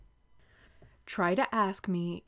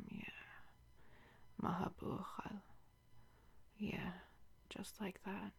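soft seductive whisper, fading quieter; intimate whisper; 10 dB quieter than usual. Acted. Guiding her lover in an intimate scene, softly whispered.

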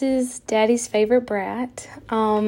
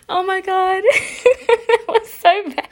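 laughing; 7 semitones higher than usual. Real, not acted. Laughing at own roleplay.

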